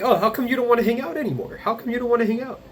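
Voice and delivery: mock him in a high, fast whine; mocking dad. Son mimicking his father's guilt-trip about not visiting, whiny mock. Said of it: "And he's like".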